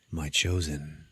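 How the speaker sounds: low, soft possessed greeting; lightly voiced possessed man; possessed different voice. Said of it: "a little bit of a different voice".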